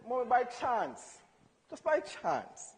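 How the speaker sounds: slow, deliberate "by chance" repeated; slow probing. Retold or imitated. Son slyly questioning his mother, slow and deliberate. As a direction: drag it out like a careful detective.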